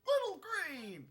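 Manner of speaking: cheery character voice. Acted. Voicing game characters.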